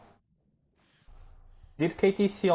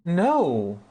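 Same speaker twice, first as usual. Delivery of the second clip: sharp, higher, louder cry of no; startled no; 6 semitones higher, 5 dB louder than usual. Real, not acted. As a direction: blurt it out in shock. Gasp, reacting to sudden event.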